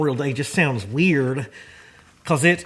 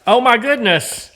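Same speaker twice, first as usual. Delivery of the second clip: real delighted gasp, pitched high and louder; surprised; 9 semitones higher, 7 dB louder than usual. Real, not acted. Opening a veteran buddy's gift, real surprise, high and loud.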